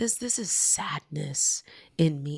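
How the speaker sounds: hushed and halting, nearly whispered; tender realization. Acted. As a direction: softly confess the hurt.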